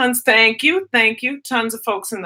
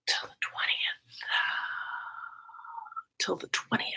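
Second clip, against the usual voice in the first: whispered in disbelief; horrified whisper; 3 semitones lower, 15 dB quieter than usual. Real, not acted. Teacher aghast a school runs till the twentieth, whisper. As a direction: breathe it out in mock horror.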